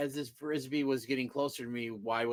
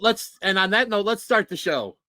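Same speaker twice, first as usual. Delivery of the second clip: louder, higher show-opening call; big announcer kickoff, louder and higher; announcer opener; 7 semitones higher, 9 dB louder than usual. Acted. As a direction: hype up the start of the show like an announcer. Host retries opener, louder.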